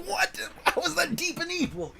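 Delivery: mock-shocked rhetorical question; incredulous. Real, not acted. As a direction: squeak out the outrage of it. Incredulous at client's contradiction.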